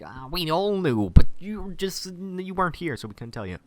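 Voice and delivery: high, loud hammed-up voice; mock confession. Imitating townsfolk in a plot twist, mock confession.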